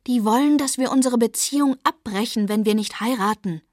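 put-on alarmed plea to her boyfriend; aghast outburst. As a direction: make him hear the danger. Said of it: "Ich bin völlig entgeistert".

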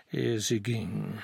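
ordinary delivery

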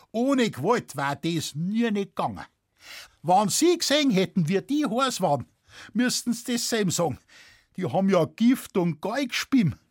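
animated farmer pleading his case; Bavarian peasant witness, insistent. Acted. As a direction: insist in broad dialect, worked up.

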